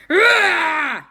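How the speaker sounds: high, loud demonstration roar; scary ghost roar. Acted. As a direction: roar to terrify someone. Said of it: "you've all gotta go".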